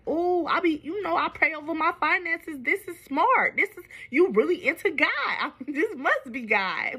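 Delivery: mock her own gullible old self, high and starry-eyed; naive believer. Woman imitating her past self praying with him, high giddy voice. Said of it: "I'm right there praying with him, like".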